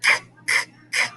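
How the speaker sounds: K, k, k breathed out with no voice; whispered cut sound for C. Shown as a demonstration. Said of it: "and we say".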